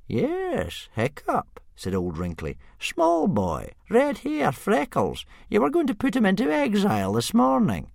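put-on dry elderly reminder; Old Wrinkly reminding the chief; old man voice. A narrator's reading.

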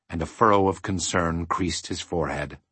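ordinary delivery

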